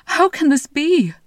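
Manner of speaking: a king crying out at his wife's restored hands; amazed cry. Acted. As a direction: cry out in disbelief. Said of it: "cried the king".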